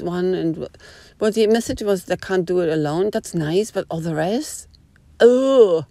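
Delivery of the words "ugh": high, loud disgusted ugh; disgusted groan. Real, not acted. Recalling the horrible reel, a loud high disgusted groan. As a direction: let out a loud groan of revulsion.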